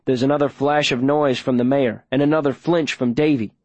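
ordinary delivery

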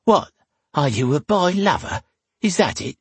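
indignant, high questioning; good humour gone. A narrator's reading. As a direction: challenge him as the offended barmaid. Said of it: "all traces of her good humour gone".

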